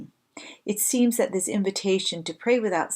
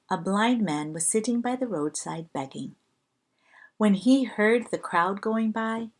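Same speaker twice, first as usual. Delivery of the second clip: normal talking voice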